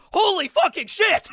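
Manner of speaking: high, booming exclamation; mock hype shout. YouTuber faking a banned video title, over-the-top shout. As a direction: blast it out like an outrageous clickbait headline.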